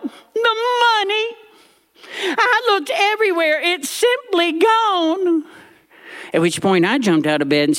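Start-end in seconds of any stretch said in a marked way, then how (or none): 0.0-5.4 high, slow distraught granny; granny crying out. Retold or imitated. Granny crying out about lost money, high and distraught. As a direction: wail it like a frantic grandmother. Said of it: "And Granny cried out".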